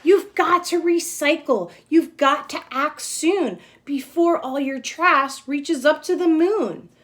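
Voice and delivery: rally the town like a caped hero; superhero urging. Acted. Hero character urging townsfolk.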